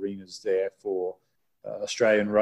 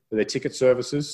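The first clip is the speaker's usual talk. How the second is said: ordinary delivery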